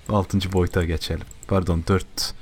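ordinary talk

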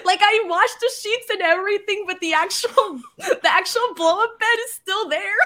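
high, giggling admission; laughing. Real, not acted. To Will about the deflated bed, giggling. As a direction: laugh through it, a little embarrassed.

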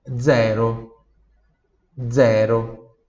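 zero said dzero, buzzing voiced start; voiced dz sound. Shown as a demonstration. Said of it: "tem esse som de z, z".

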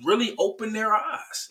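high-pitched, hammed-up alarm; mock panic at a sudden realisation; scared. Adam and Eve hiding from God, scared. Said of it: "because they scared".

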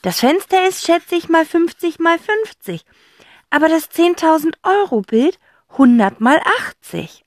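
put-on high, loud boy's voice reasoning it out; clever boy. Zack objects to his sister, working out the sizes.

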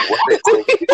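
words dissolve into a high squealing laugh. Real, not acted. Friend laughing at his own resume story, high squeal. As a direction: crack up mid-sentence, voice shooting way up.

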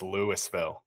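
Louisville said with the S sounded, LOO-iss-vill; Colorado pronunciation. Shown as a demonstration. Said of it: "it's pronounced Louisville".

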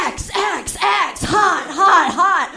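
hammed-up sexy promo chant; mock flashing announcer. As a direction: shout it like a cheesy promo. Screen flashing text.

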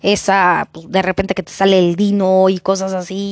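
usual speaking voice

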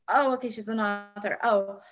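ordinary talk

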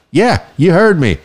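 normal talking voice

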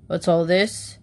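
stern man's voice at an ordinary pitch; stern official. A narrator's reading. Man in dark blue suit overhearing the family, stern. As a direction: demand it sternly, as an official would. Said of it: "grew very stern as he listened".

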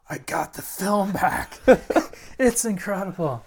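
can't contain the good news; excited message. Retold or imitated. Brad on the answering machine, thrilled about the film.

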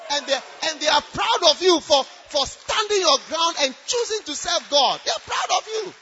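loud, emphatic pulpit proclamation; forceful preaching. Real, not acted.

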